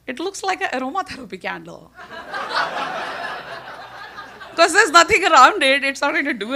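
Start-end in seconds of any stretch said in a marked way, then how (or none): 2.0-4.5 breathy laugh. Real, not acted. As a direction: chuckle at the scene. Laughing at story.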